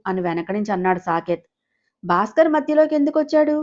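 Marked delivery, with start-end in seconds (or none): 2.0-3.6 a much higher startled question; a father hearing an unexpected name, shocked. A narrator's reading. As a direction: ask it in shock.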